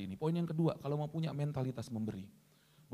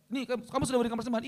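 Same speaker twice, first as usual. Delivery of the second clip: lightly acted rapid, higher questioning; questioning apostle; 8 semitones higher, much faster than usual. Apostle questions the late-arriving wife, lightly acted quick questioning. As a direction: fire off the question, pitched up.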